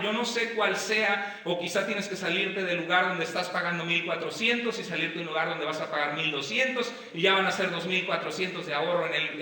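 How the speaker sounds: ordinary talk, nothing marked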